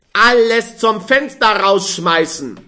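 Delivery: loud, high, rejoicing shout; boisterous tipsy cheer on Alles; jubilant. A narrator's reading. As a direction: cheer it out drunk and happy. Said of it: "jubelte der Früchtehändler".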